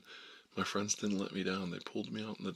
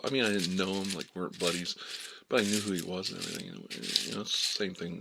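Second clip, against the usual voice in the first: usual speaking voice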